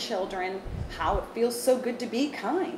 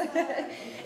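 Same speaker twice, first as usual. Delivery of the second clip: light laugh; 7 semitones higher than usual. Real, not acted. Laughs after singing.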